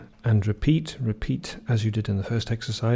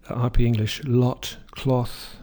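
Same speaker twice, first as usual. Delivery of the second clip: RP "lot" and "cloth" both with a short rounded o; received pronunciation, both words short o; British RP vowel; RP accent; slower than usual. Shown as a demonstration.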